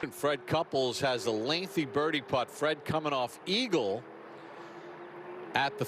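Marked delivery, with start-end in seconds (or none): none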